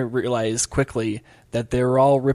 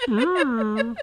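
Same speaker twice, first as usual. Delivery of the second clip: Marvin the Martian; 10 semitones higher, 3 dB quieter than usual. Retold or imitated. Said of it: "Marvin the Martian impression".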